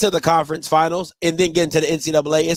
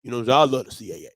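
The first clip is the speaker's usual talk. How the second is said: imitating Hampton; 9 semitones lower, 4 dB quieter, faster than usual. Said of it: "be like".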